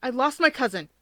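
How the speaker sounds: anxious blurt. A narrator's reading. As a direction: blurt it out in a panic. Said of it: "Kudo blurted out".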